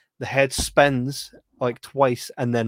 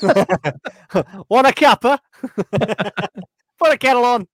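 higher, louder hammed-up English accent; playing a cosy English host with an English accent; mock English accent; 9 semitones higher, 5 dB louder, slower than usual. Teasing friend after love, mock English accent.

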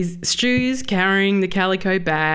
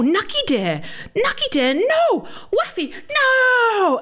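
imitating a toddler; 14 semitones higher, slower than usual. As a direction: cry out like a distraught two-year-old. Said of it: "he's pointing to the hole".

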